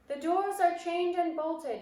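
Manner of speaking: high, loud answer slowing at the end; lightly voiced witch reporting to her queen; mildly voiced servile witch; a hint of a witch in the crowd. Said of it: "answered a voice in the audience".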